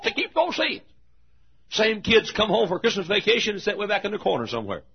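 high, loud pulpit storytelling; full-voiced preaching. Real, not acted. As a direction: tell it at the top of your preaching voice.

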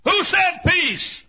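high, loud, drawn-out demand; shouted question. Acted. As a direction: shout it like an armed warrior on guard. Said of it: "manning his weapon, said".

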